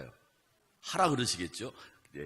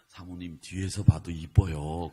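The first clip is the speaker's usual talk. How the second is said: tempting Satan; 3 semitones lower, 9 dB louder than usual. Retold or imitated. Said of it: "사탄이 뒤에서 하는 소리가".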